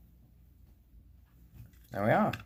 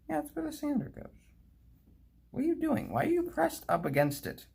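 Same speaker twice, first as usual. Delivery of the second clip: much higher voice scolding a part; mock scolding voice. Talking to a stubborn sander, in a high mock scold. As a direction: scold the little part like a naughty pet.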